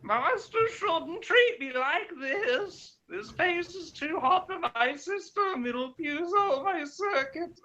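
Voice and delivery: high, slow put-on robot girl voice; Caroline voice. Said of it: "You guys wanna hear my Caroline voice again?".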